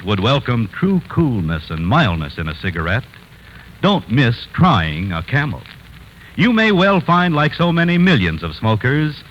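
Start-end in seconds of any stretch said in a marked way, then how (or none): none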